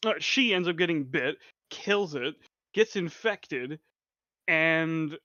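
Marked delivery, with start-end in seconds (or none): none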